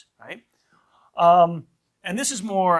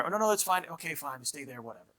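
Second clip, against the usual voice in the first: giving in; 6 dB quieter than usual. Acted. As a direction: let it drop, trailing off softly. Parent giving up on an order.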